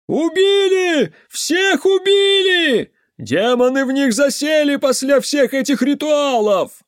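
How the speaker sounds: very high, loud, drawn-out cries; hysterical wailing shout. A narrator's reading. Villager accuses them of killing children, hysterical shouting. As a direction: wail the accusation to the whole crowd.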